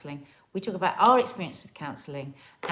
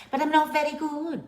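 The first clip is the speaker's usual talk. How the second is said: Welsh accent; 8 semitones higher than usual. Shown as a demonstration. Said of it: "try and do a Welsh accent".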